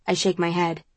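ordinary delivery, nothing marked

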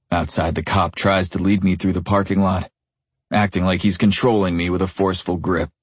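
ordinary delivery

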